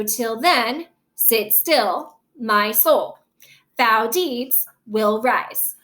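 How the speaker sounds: "Till then, sit still" in separate two-beat pairs; iambic stress. Shown as a demonstration. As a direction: lean on "then", "still", "soul", "deeds", "rise". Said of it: "this syllable is not stressed".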